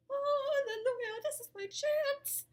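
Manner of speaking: high, loud and thrilled; giddy excitement. Retold or imitated. Girl seeing her chance with the guy, high and thrilled. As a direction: squeal at a sudden chance. Excited thought when they split.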